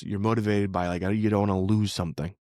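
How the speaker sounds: ordinary talk, nothing marked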